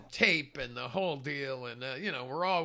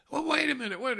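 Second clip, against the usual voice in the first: startled protest; 9 semitones higher, 5 dB louder, faster than usual. Retold or imitated. Protesting being thrown out.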